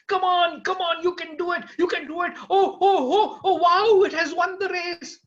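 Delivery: high, loud cheering with laughs; excited shouting. Acted. Man cheering a distant horse race, excited shouting. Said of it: "jumping with excitement and shouting out".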